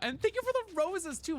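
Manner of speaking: very high-pitched gushing thank you; excited thanks. Real, not acted. Streamer thanking a viewer for roses, excited thanks.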